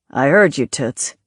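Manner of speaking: low, tough gangster growl. A narrator's reading. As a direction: play the hard-nosed mobster. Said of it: "he said".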